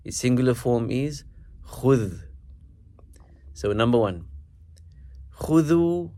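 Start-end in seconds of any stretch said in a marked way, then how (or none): 5.4-6.1 khudhu met de nadruk op de kha, hoog en luid ingezet; stress on kha. Shown as a demonstration. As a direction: begin khudhu krachtig op de kha. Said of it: "obviously you're emphasizing the kha".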